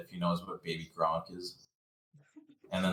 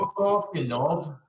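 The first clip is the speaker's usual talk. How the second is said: mock British insults; 7 semitones higher, 4 dB louder than usual.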